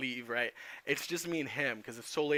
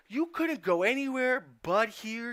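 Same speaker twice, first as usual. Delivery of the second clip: higher, slow fed-up cop voice; scolding cop; 10 semitones higher, 5 dB louder, slower than usual. Retold or imitated. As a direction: lecture him like a weary arresting officer. Cop scolding the arrested teen.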